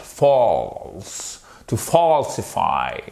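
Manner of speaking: slow "False", long AW from the throat; throaty vowel demo. Shown as a demonstration. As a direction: stretch FAWL in "False" and "falsify". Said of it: "ça se fait vraiment avec la gorge". (« False » très allongé, avec la gorge)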